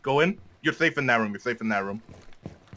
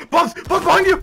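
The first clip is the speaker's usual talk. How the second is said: high, loud alarmed yell; shouting warning; 13 semitones higher, 4 dB louder than usual. Real, not acted. Warning teammate of enemy.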